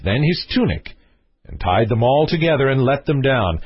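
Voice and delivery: ordinary delivery, nothing marked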